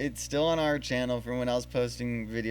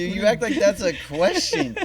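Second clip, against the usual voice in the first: higher, louder, laughing through it; mock disbelief through laughter; laughing tease; 9 semitones higher, 10 dB louder than usual. Real, not acted. Host teasing the storyteller about the obvious answer, laughing.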